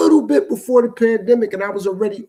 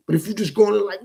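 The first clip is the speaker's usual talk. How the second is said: ordinary delivery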